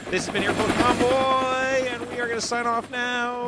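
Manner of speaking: big high radio sign-off; announcer voice. Acted. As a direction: boom it out like a DJ.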